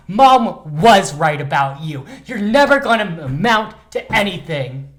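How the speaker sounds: loud, high scolding sister voice; scolding sibling. Acted. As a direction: yell at a lazy drunk brother. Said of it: "decided to reenact this moment".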